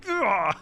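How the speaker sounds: high-pitched mock "ugh"; frustrated groan. Reacts to undoing his progress.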